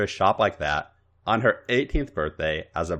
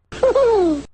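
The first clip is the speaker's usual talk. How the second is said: high giggle; 5 dB louder than usual. Acted.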